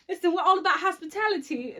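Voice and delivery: attempted Southern accent. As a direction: try on a failing Southern accent. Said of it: "That's not southern at all".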